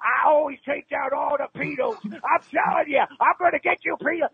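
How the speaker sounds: over-the-top tough-guy threats; mock menacing vigilante. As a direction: play a cartoonish avenger taunting. Caller hams up vigilante threats.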